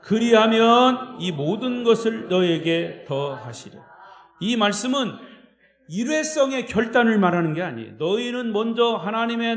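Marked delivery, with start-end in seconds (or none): 0.0-3.8 slow, loud chanted verse; unison scripture recitation. Shown as a demonstration. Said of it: "시작".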